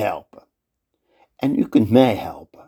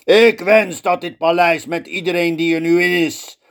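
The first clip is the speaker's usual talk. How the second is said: higher, louder victorious cry; triumphant shout; 7 semitones higher, 3 dB louder than usual. Acted. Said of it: "Hij schreeuwt".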